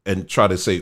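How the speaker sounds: normal talking voice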